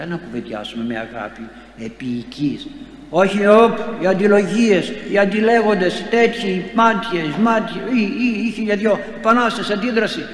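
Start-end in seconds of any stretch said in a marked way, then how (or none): 3.1-10.2 loud, high rant against quarrels; loud, heated pulpit outburst; fiery preaching. Acted. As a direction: hammer out every word of strife. Preacher lists strife, rising.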